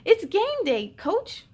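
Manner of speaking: put-on a child's excited holler; excited yell. A narrator's reading. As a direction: call out to Dad, eager. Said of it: "Lulu yelled".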